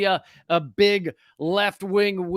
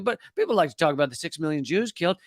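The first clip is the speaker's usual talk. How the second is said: usual speaking voice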